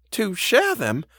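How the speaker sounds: high-pitched housekeeper prompting; questioning echo. A narrator's reading. Housekeeper presses the faltering governess, high questioning echo. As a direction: take the words up high as a question.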